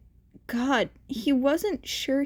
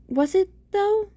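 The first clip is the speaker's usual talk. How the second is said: high doubting voice; 9 semitones higher, 3 dB louder than usual. A narrator's reading. Inner voice questioning.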